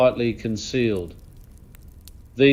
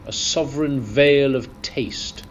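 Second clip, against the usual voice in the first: usual speaking voice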